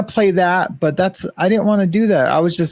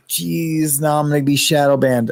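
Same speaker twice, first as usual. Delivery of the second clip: normal talking voice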